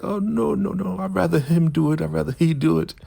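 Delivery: very high whimpering falsetto; hammed-up frightened refusal; mock panicked pleading. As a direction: plead frantically in a put-on high voice. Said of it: "now she's begging".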